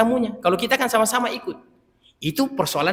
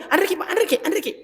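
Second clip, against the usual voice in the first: much higher, coaxing host voice inviting to eat; mock Bugis host urging, much higher; imitating a host; 11 semitones higher than usual. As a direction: coax the guest to eat more, high and sweet. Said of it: "Makanya nih".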